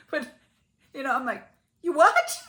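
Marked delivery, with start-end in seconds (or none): 1.8-2.4 high, loud mock disbelief; incredulous outburst. Wife retelling her reply to fainted husband, incredulous outburst. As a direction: blurt it in retold astonishment.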